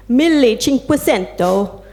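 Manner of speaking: put-on slow, gently Italian-accented words. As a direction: say it like an Italian speaker, unhurried. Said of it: "in an Italian accent".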